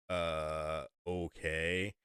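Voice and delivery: hesitate, sizing up a huge stranger; mock wary hesitation. Wary reply to towering stranger.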